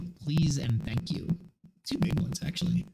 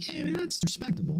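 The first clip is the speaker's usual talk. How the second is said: much higher, impressed and approving; impressed high exclamation. Retold or imitated. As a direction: squeak out your approval, way up high. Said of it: "I was like".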